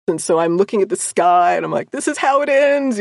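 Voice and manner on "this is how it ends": higher, louder terrified line; mock doom. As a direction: melodramatic dread at the sky. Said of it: "I was just totally freaked out".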